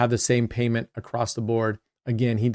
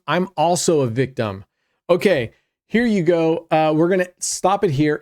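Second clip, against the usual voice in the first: ordinary talk, nothing marked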